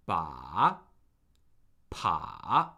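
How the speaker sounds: "Ba" and "pa" dipping very low, then rising; third tone. Shown as a demonstration. Teacher drilling the third tone, low dip and rise on "Ba, pa". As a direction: drop each "Ba, pa" to the bottom and swing up. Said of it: "that is the third tone".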